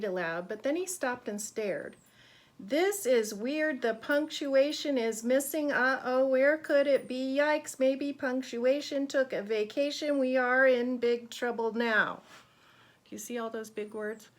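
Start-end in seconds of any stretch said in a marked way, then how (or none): 2.6-12.2 mock alarmed teacher. Teacher finds punctuation missing.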